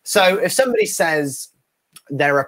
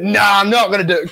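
higher-pitched imitated protest; mock refusal; 5 semitones higher, 4 dB louder than usual. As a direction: refuse like a friend digging in. Friend refusing in acted argument.

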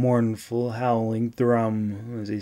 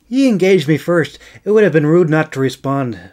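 insisting; 5 semitones higher, 7 dB louder, faster than usual. Acted. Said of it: "she insisted".

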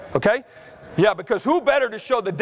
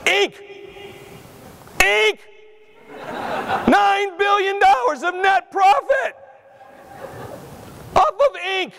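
outraged shout; 10 semitones higher, slower than usual. Real, not acted. Keynote speaker ranting to the audience about ink prices, shouting. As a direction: yell it like the price is a crime.

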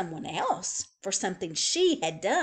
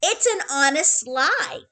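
put-on high, louder little-girl voice; proud childish voice; 7 semitones higher, 8 dB louder than usual. A narrator's reading. Little girl to her mother, proud and high. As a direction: say it like a clever discovery.

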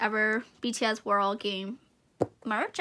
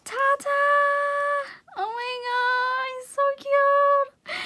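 much higher, squealing and near tears over the cute doll; overwhelmed squeal; 13 semitones higher, 4 dB louder, slower than usual. Real, not acted. Unboxing a tiny doll for viewers, squealing close to tears. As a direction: let it burst out high and teary.

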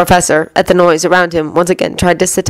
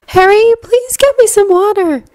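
gentle. A narrator's reading. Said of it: "she told him before gently".

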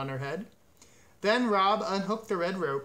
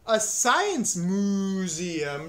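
very drawn-out, loud moan; 3 semitones higher, 5 dB louder, much slower than usual. A narrator's reading. Cow balks at the museum, moaning. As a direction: stretch each vowel in dismay. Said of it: "Martha moaned".

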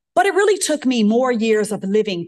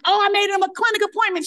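proud answer; 14 semitones higher, faster than usual. Retold or imitated.

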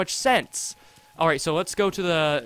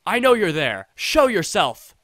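loud read-out game dialogue challenge; shouted challenge; 2 semitones higher, 7 dB louder than usual. Acted. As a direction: call out the hidden figure, slowing on the demand. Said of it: "You don't have to shout".

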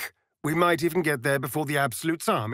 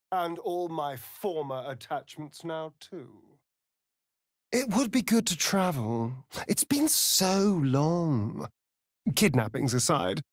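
normal talking voice

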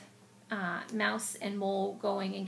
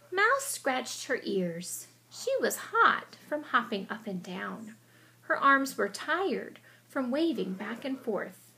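story narration; 5 dB louder, slower than usual. A narrator's reading. Expressive read-aloud narration.

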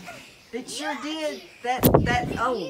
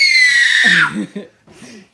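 shrieking laugh; loud laughing shriek; loud burst of laughter; 15 dB louder than usual. Real, not acted.

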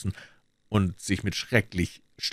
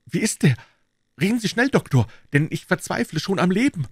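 a pleading, lightly raised voice; urgent Silas; 11 semitones higher, 4 dB louder than usual. A narrator's reading. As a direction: hurry him, half desperate. Desperate Silas speaking.

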